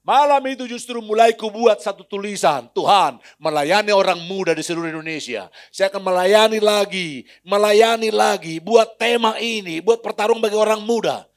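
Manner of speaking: preacher exhorting the congregation at full force; full-force preaching. Real, not acted. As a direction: drive the exhortation home.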